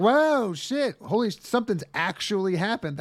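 high, loud burst of alarm; mock startled. Kids yelp as a tooth flies out. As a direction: yelp like the kids just got spooked. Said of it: "And they're like".

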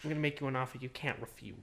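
low, quiet mobster voice, fast; Godfather voice. Retold or imitated. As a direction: murmur it like a mafia boss. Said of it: "He's like the boss baby".

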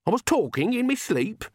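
put-on high, alarmed old woman; dismayed at what she let slip. A narrator's reading. Granny alarmed.

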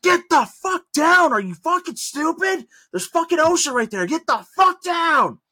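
high, loud screamed order; furious yelling. Retold or imitated. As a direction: imitate a coworker losing it and screaming. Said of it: "starts yelling at him".

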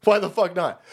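ordinary talk

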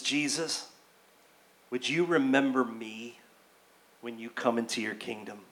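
low, very soft, humble plea; hushed plea. Acted. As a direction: ask Jesus quietly, pausing between phrases. Acted dying criminal's plea to Jesus.

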